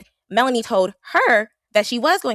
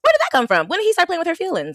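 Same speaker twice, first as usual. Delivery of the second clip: incredulous; 12 semitones higher than usual. Real, not acted. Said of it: "incredulous questions".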